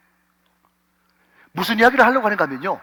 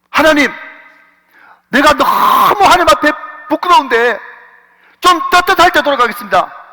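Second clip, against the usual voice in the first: mock hesitant believer; 8 semitones higher, 7 dB louder than usual. Voicing hesitant believer's prayer.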